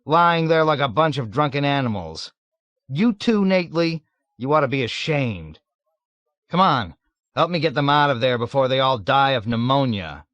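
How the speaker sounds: loud, high reproach; indignant scolding. A narrator's reading. Clevenger scolding drunken friends in the mud, indignant. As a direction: scold them like a prim officer. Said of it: "exclaimed indignantly".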